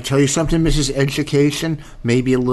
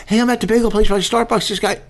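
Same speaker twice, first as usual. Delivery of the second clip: high, hurried mock plea; urgent phone report; 8 semitones higher, faster than usual. Husband acts out what his wife should have told him, urgently. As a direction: imitate a wife rushing out an alarm, pitched up. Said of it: "If you would have told me".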